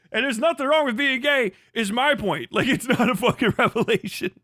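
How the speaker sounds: normal talking voice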